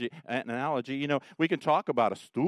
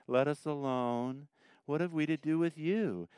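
mild mock reading; 2 semitones higher, 5 dB quieter than usual. Shown as a demonstration. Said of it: "let me do the Toby thing".

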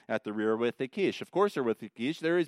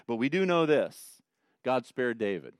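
ordinary delivery

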